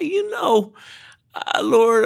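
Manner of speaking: very high, comic plea; mock reluctant prayer. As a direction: send up your own half-hearted praying in a high voice. Acts out his half-hearted prayer.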